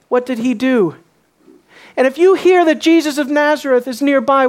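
ordinary delivery

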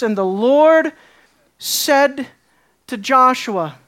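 slow reading with a pause before and after "said"; weighty scripture reading. Shown as a demonstration. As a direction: let "Lord" and "said" each land, with pauses between. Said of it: "soak in that first verse".

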